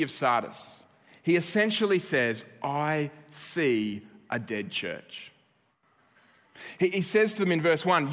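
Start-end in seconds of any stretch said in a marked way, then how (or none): 2.6-5.3 put-on slow, low and eerie, like the ghost boy; a hint of the haunted child; spooky movie echo. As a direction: let it hang, slow and ominous. Echoing the spooky movie quote.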